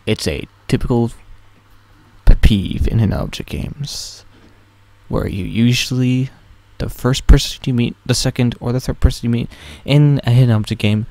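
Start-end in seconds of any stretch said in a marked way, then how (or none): none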